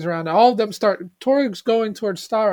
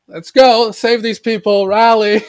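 mock rallying call; 6 dB louder, slower than usual. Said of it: "takes charge and goes".

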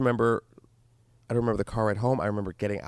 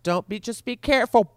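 mock warning; 10 semitones higher, 5 dB louder than usual. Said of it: "on the drop of a dime, just".

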